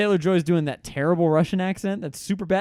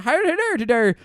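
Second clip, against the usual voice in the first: put-on higher-pitched garbled Irish accent; mock Irish accent; 6 semitones higher than usual. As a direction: do a bad, exaggerated Irish accent.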